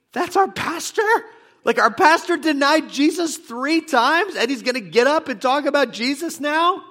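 very high, loud, outraged disbelief; incredulous churchgoer. Acted. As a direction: squawk it as an appalled congregant. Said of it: "sitting there in Rome thinking".

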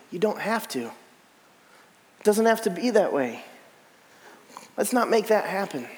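quiet, slow, coaxing devil; Satan whispering temptation to a weary Jesus; soft tempting Satan. Retold or imitated. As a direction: lure him gently, a hint of menace.

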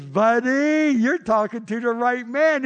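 high, loud, gleeful comeback; retold excited reply. Preacher answering the wild football fan, excited.

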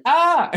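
high, loud mock "ah"; mock panicked yelp. Interviewer imitating stumped guests, mock panicked yelp. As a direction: yelp like someone stumped by a question. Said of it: "or they're like".

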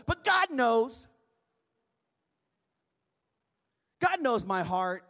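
drawn-out mocking of the excuse; slow mock excuse. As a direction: stretch it like a lame excuse. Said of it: "those who say".

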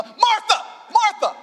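high, loud yelled Martha, Martha; shouted Martha. Shown as a demonstration. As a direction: bellow the names across the room. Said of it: "if it's me, uh".

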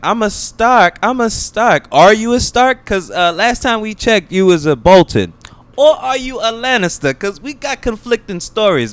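high-pitched, sassy interrogation; mock confrontational challenge. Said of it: "She's like".

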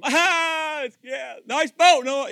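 high, loud sarcastic jeer; mocking. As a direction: sneer at the boat builder. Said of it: "people mock them".

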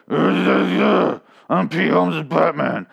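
hammed-up Batman growl; Pete Holmes' Batman. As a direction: drag it out like a mock superhero. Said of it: "when he does, it's like".

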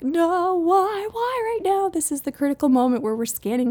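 high, pleading mom panic at the checkout; exasperated plea. Retold or imitated. Mom at checkout pleading with her toddler, exasperated and high. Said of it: "and I was like".

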